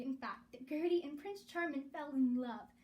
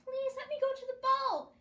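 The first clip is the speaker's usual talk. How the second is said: high, loud pleading wail; wailing plea; 12 semitones higher, 7 dB louder than usual. A narrator's reading. Gertie begging Missus Ugly to go, loud wailing. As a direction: beg desperately for the ball. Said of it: "Gertie wailed".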